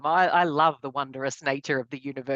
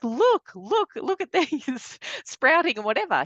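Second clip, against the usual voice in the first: much higher, eager "look, look" with a laugh; excited calling; 8 semitones higher than usual. Retold or imitated. Wife urging husband to see new shoots, excited and high. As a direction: pull her husband's eyes to the shoots. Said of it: "calling my husband and bringing him out".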